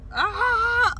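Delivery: short loud shriek of fright; high frightened scream. Real, not acted. Driver scared by a bug, shrieking. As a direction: yelp at the bug.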